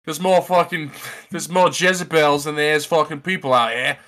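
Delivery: put-on Australian voice; Australian accent. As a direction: grumble like an Aussie local. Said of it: "And they're like".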